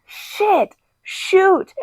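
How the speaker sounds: high, loud, mock outrage; fired-up hangover buddy, hammed up; hyped-up buddy rant. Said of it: "To where it's like The Hangover".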